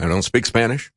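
normal talking voice

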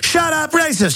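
high-pitched sneering mock shout; mocking accuser. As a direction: squeal it like a hysterical accuser.

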